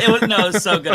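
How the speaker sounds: loud, high, through laughter; laughing praise. Real, not acted. Praising the co-host's hymn bit, laughing. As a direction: gush it while cracking up.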